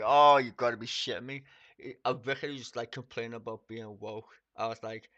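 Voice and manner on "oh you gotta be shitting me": much louder, incredulous outburst; loud disbelief. Retold or imitated. Streamer reacting loudly to the woke vicar on air. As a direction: blurt out your disbelief.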